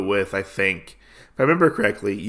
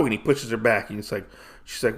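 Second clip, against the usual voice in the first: normal talking voice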